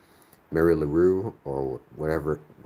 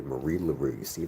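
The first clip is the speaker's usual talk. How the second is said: usual speaking voice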